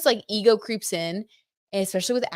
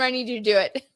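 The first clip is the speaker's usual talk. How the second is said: ordinary talk, nothing marked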